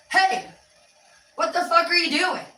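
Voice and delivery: high-pitched, annoyed confrontation; kinda pissed. Shown as a demonstration. Demo of a tone that starts a fight, kinda pissed. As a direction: sound pissed, in short separate bursts. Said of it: "That can seem like you're kinda pissed".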